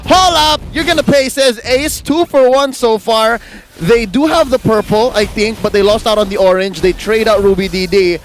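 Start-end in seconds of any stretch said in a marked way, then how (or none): 0.1-3.4 fast excited play-by-play; esports caster. Real, not acted. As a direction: call the fight live. Live play-by-play of a teamfight.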